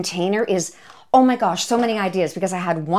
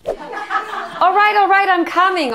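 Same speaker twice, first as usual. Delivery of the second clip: sing-out high reply to guests; high calling out; 13 semitones higher, 5 dB louder, slower than usual. Pretend. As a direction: call to the impatient guests, high and loud. Calling to impatient waiting guests.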